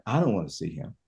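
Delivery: low, quiet, weary refusal; Mary refusing, low. Retold or imitated. Mary to Jesus, refusing to see him, low and weary. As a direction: sink the voice, tired and hurt. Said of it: "It's like".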